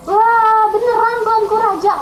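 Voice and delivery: high, loud, delighted disbelief; overjoyed servant. Acted. Servant asking the king if it's true, overjoyed. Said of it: "dia seneng banget".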